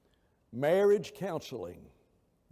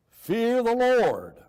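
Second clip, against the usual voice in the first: FEAR THE LORD boomed high, loud and drawn out; emphatic; 8 semitones higher, 10 dB louder, much slower than usual. Shown as a demonstration. Preacher driving the verse home to his class, very emphatic. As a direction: thunder "Fear the Lord", holding the words long. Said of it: "emphasize every word".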